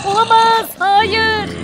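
panicked cry. Acted. Panicked waking in TV nightmare.

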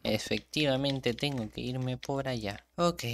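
ordinary delivery

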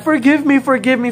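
high loud pleading; begging. Acted. Someone begging forgiveness, high loud plea. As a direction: cry out for forgiveness. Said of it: "someone who said".

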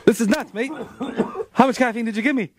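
put-on British accent. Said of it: "[name]'s English guy, uh, too much caffeine".

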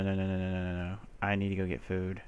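ordinary talk, nothing marked

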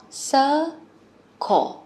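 "Circle" split into two syllables, sir-cle, falling; two-syllable model. Shown as a demonstration. Teacher shows the children the word's two syllables. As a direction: stress that "Circle" has two sounds. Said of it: "Hai âm ha các con".

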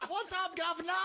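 high, comic Cockney greeting; mock Cockney accent. Spoof of taming Channing's speech, high Cockney accent. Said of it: "taming of the Channing".

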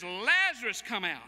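Jesus calling Lazarus out of the tomb; calling by name. Acted. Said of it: "He says".

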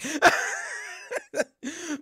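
high, fading wheezy laugh; real long giggling fit; helpless laughter. Real, not acted. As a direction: crack up at your own line. Laughing at own flubbed joke.

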